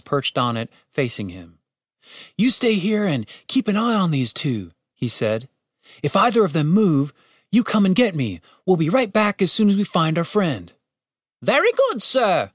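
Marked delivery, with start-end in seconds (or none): 2.4-4.7 put-on higher-pitched earnest young-hero voice. A narrator's reading. Julian tells his bird to guard prisoners, instructing. 6.0-10.7 put-on higher-pitched earnest young-hero voice. A narrator's reading. Julian tells his bird to guard prisoners, instructing. 11.4-12.5 very high-pitched little creature voice; obedient familiar. A narrator's reading. Bird familiar answers its master's order, obediently.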